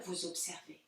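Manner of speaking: ordinary talk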